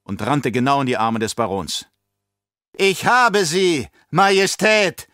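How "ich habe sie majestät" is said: slow, loud triumphant crow; triumphant shout. Acted. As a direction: gloat it out slowly and loud. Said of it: "schrie Baron Harkonnen triumphierend".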